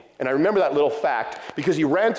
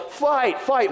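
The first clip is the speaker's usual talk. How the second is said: retold yell; 9 semitones higher than usual. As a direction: imitate a kid calling out a fight on the bus. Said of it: "somebody yells".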